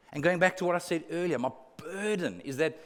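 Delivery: normal talking voice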